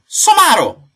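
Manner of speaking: very high, very loud outburst; furious shrieked name-calling; shouted insult. Acted. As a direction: hurl it back at the top of your voice. Trading insults.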